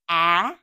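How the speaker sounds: isolated short a vowel; short a sound. Shown as a demonstration. Teacher modeling the short a sound for kids. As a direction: say the short a sound clearly, like in apple. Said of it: "the short sound is".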